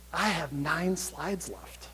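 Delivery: a slow, hushed aside to the audience. Real, not acted. Speaker confides the slide count, hushed and slow. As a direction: drop it to a hushed confession.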